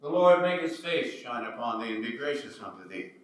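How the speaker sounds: normal talking voice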